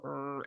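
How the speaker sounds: soft, low annoyed growl under the breath; a quiet frustrated grumble. Retold or imitated. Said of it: "for a second I go".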